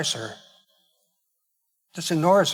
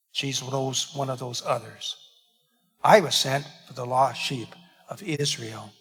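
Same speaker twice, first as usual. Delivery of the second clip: usual speaking voice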